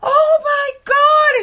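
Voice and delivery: high, loud, drawn-out oh-my-God; imitated astonished gasp. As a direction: retell her stunned cry, stretched and high. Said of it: "And the girl goes".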